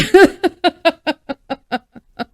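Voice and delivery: a high, loud burst of laughter. Real, not acted. Catching her own craving, a high loud laugh. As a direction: crack up at yourself.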